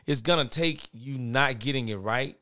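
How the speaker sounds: ordinary talk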